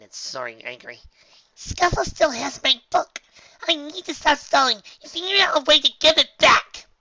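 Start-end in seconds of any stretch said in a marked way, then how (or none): 1.5-6.9 loud high determined filly. Acted. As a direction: resolve to get it back. Dinky determined.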